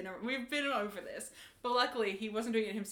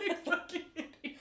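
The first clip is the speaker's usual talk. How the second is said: laughing; 8 semitones higher than usual. Retold or imitated. Laughing insult in retold story.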